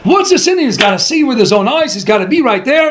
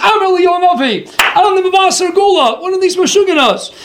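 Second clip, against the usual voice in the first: raised, a lightly voiced madman proclaiming himself; mock madman's proclamation, pitched up; crazy man's claim; 7 semitones higher than usual. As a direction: announce it grandly, a hint of a crazy man.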